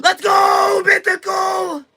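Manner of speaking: very high, drawn-out hype shout; high-pitched streamer victory yell; excited cheer. Real, not acted. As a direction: squeal it out in celebration. Streamer celebrates a mythical pull.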